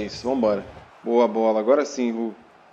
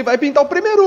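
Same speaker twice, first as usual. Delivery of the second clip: rising, louder, hyped call; getting hyped as the shot comes; excited; 9 semitones higher, 6 dB louder, faster than usual. Real, not acted. Goal chance building in FIFA.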